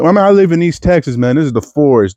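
usual speaking voice

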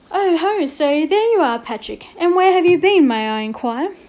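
a teacher savouring catching him out; lightly voiced schoolteacher, arch and sarcastic. A narrator's reading. Teacher catches late pupil.

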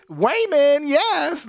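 high, drawn-out campy exclamation; effeminate worker imitation. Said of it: "you just knew he was in there just".

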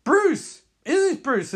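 high loud burst of recognition; Barry thrilled to see Bruce, high excited shout. Acted. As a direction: shout the name like a wild relief.

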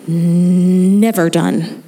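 a weary, knowing "Never done"; drawn-out emphasis. Acted. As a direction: lean on "Never", stretch every sound. Moms' work never done.

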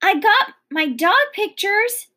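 high-pitched, excited little cry; mild storybook scream; narrated scream. A narrator's reading. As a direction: call it out high, without full volume. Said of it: "screamed Sweetie Pie".

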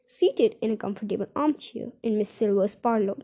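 ordinary delivery

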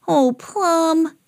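high, drawn-out storybook dismay; Sam. A narrator's reading. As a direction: lament a naughty dog in a high voice. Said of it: "said Sam".

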